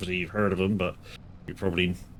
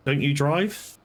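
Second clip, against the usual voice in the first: "Don't you drive?" higher, with a teasing rise on "drive"; teasing disbelief at a viewer who doesn't drive; incredulous question; 6 semitones higher, slower than usual. Shown as a demonstration. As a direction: ask it like you can't believe it. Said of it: "I'm not saying that as a".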